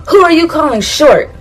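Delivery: indignant high, loud outburst; Edward Elric. Acted. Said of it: "it's the line he says".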